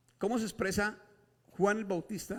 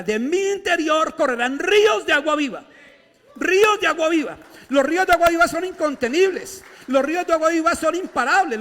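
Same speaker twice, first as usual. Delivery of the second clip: much higher, much louder fiery preaching; preaching at full force; 9 semitones higher, 12 dB louder than usual. Acted. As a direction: build into a shouting climax. Preaching crescendo.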